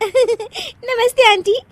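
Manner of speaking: high, slow hello through giggles; giggly greeting. Acted. Daughter-in-law greets mother-in-law's friends, giggling. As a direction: greet guests while stifling laughter. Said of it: "हंसने वाली दवा खा लेती है".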